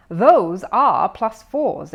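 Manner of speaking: slow, loud, deliberate explaining. A narrator's reading. Mother explaining the odd trousers, slow and loud. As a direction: spell it out slowly. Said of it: "explained Missus Brown".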